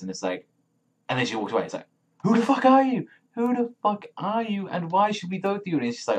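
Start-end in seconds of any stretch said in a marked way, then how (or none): 2.2-5.8 high-pitched acted indignation; mock outrage. Crowd reacting as she walks away, mock outraged. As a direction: squawk the challenge in put-on outrage.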